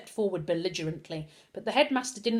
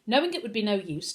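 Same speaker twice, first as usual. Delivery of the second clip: ordinary delivery, nothing marked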